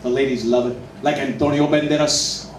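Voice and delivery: low, smooth seductive Spanish voice; smooth Latin lover. Retold or imitated. Comic imitating a suave Spanish heartthrob, low and smooth. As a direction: purr it like a suave leading man. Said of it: "they sound good".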